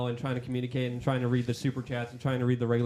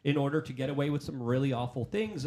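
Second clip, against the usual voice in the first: ordinary talk, nothing marked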